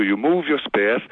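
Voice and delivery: usual speaking voice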